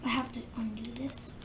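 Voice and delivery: whispered to self. Real, not acted. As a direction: mutter it under breath. Fiddling with toy, whispering.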